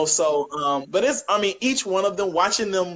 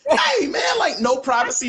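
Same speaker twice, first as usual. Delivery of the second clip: mock shout; 8 semitones higher than usual. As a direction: yell it out in comic outrage. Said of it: "you're like".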